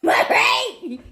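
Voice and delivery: high, loud mock cry to annoy managers; hammed-up high-pitched yell; mock outburst. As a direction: blurt it high and loud, playfully. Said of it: "I'm gonna be like".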